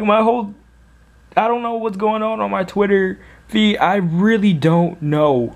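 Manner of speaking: high, squealing giddy rush of joy; fanboying excitement. Real, not acted. Fan thrilled his idol retweeted him, excited squeal. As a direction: gush like a thrilled superfan. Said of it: "I'm fanboying like crazy".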